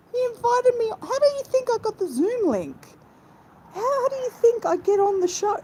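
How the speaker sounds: indignant protest. Retold or imitated. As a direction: squeal back, insulted by the claim. Retells defending herself on show.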